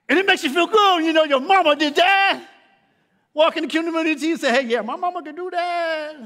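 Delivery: loud, high preacher's build; full-force preaching. Acted. Preacher rousing the congregation about mamas, loud preaching.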